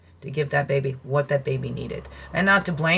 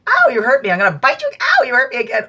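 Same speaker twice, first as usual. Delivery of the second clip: high, louder mock yelp as the hurt dog; 8 semitones higher, 7 dB louder, faster than usual. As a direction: voice the pup crying out at the quills. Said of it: "It was like they didn't learn".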